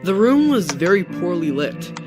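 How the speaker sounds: plain reading voice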